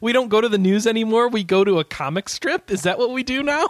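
high, loud disbelief; incredulous. Real, not acted. Host baffled that a customer went to a comic strip, loud disbelief.

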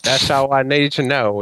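ordinary talk, nothing marked

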